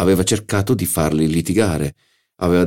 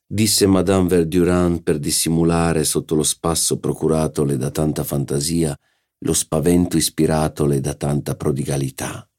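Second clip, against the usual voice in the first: usual speaking voice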